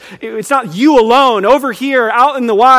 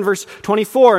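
usual speaking voice

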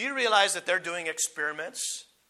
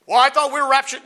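mock objector; 7 semitones higher, 9 dB louder than usual. Voicing an objector's question.

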